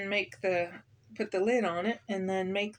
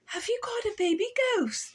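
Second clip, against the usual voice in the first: much higher lady voice, eager; lady's excited cry; 12 semitones higher than usual. A narrator's reading. Lady visitor cries out, excited about a baby ghost. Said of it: "the lady cried".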